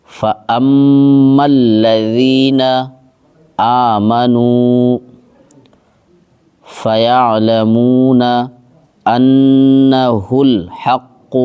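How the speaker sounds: slow, drawn-out tajweed chant; Quran recitation. Shown as a demonstration. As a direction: recite each word long and measured. (تجوید کے ساتھ آہستہ تلاوت)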